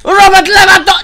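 high, loud excited yell; shouted name. Real, not acted. Gamer cheering his striker on, loud shout. Scoring chance in game.

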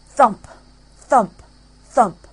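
heavy booming thump thump thump; thunderous footfall; loud thumps. A narrator's reading. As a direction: stomp each word out loud like giant footsteps. Giant's footsteps approaching.

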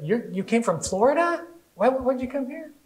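high, incredulous questioning; mock astonishment. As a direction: imitate a stranger who can't believe it. Shopper baffled at the move.

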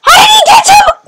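very high, loud squeal; real high-pitched outburst; shrieked disbelief. Real, not acted. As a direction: shriek in baffled shock. Shocked at opponent in game.

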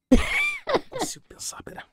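slow, breathless whisper; panicked whisper. Real, not acted. Streamer overwhelmed after Neymar follows him, slow whisper. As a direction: whisper it, too stunned to think.

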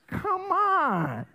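loud, high come on in disbelief; incredulous. Real, not acted. Pastor scoffing at the idea to congregation, loud and high. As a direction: throw it out in mock disbelief. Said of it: "how ridiculous is that".

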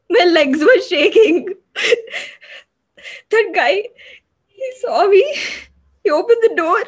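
loud, very high sobbing wail; panicked, anguished sobbing confession; hysterical crying. Acted. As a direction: cry out through sobs, loud and high. Reliving the attack and running away.

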